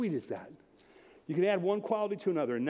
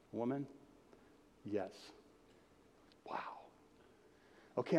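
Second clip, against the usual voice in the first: soft, low, halting talk to God; hushed, grateful murmured prayer; humble acted prayer; 4 semitones lower, 5 dB quieter than usual. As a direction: let wonder sink in quietly, with long pauses.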